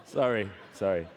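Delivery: low apology through a laugh; real laughing apology. Real, not acted. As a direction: crack up and say sorry. Host cracks up mid-introduction.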